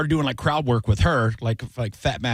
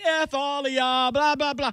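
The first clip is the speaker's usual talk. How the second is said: mock cussing; 13 semitones higher than usual. Comic dragged out while cussing at the owner, mock hollering. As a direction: holler curses high as if being dragged off. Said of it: "I'm just cussing at him".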